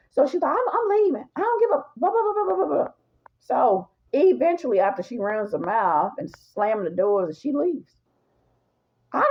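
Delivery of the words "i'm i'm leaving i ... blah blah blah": high, dismissive rant; the other woman storming out, high dismissive rant. Retold or imitated. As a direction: mimic her ranting as she storms out.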